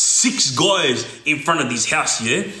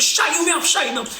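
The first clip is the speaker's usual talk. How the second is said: mock snapping; 10 semitones higher than usual. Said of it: "start going off".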